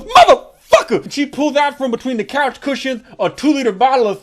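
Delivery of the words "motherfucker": high, loud shriek of rage; woman losing it at the taunt; furious shout. Retold or imitated. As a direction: scream it at him. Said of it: "And she goes".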